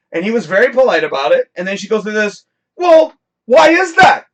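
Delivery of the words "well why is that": high, loud, slow, drawn-out whine; mock snotty demand. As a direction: mimic her entitled tone, slowly and loudly. Woman's demanding question, mocked.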